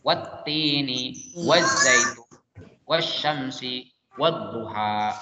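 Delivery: "Wattini waz-zaitun wasyamsi wadduha" slow, each doubled consonant held; tajwid recap. Shown as a demonstration. Teacher sums up the examples for pupils, slowly. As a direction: recite the four words slowly, with no l. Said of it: "dibaca langsung".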